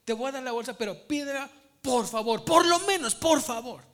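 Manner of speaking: put-on a parent coaxing a child to ask nicely, rising to a plea; pretend talk to girl. Preacher imagines telling the girl to say please, building to a loud high plea. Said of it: "Le hubiera dicho a la niña".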